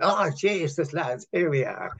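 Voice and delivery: hammed-up awful Irish accent; mock Irish accent, starting high; bad Irish accent. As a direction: imitate someone suddenly putting on terrible Irish. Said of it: "a bloody awful Irish accent".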